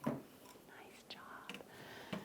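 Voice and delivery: breathy unvoiced "nice job"; hushed whisper of praise. Real, not acted. Quiet praise to child.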